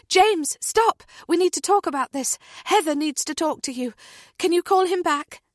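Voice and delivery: loud, high urgent stop; urgent command. A narrator's reading. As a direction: cut him off mid-call. Natalie stops James' call.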